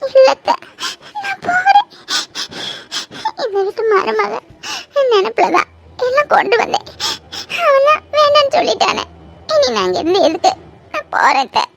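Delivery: put-on tearful girl telling her aunt she is going; crying. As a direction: sob out that she is leaving. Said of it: "கண்ணை கசக்கிட்டு இருக்கே".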